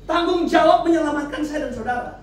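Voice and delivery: loud, high-pitched sermon delivery; impassioned preaching. Real, not acted. Preacher telling congregation of Jesus's struggle in Gethsemane, impassioned preaching. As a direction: shout each phrase out to the congregation, pausing between.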